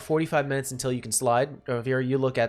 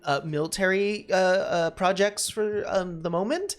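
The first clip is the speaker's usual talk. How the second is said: put-on Valley Girl voice; 8 semitones higher than usual. GM voicing Gina Lee.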